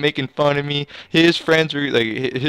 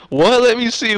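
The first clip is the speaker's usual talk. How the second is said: high, loud sudden what; startled surprise; 10 semitones higher, 5 dB louder than usual. Retold or imitated. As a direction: jump at what you see. Retold spotting the hobo.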